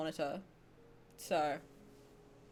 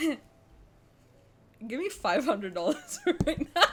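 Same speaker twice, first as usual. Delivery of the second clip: slow words through laughter; laughing; much slower than usual. Real, not acted. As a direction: giggle through the demand. Laughing demand for money.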